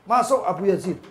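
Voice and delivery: forceful high call. Retold or imitated. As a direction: holler the guest inside. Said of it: "sudah diteriaki dari depan".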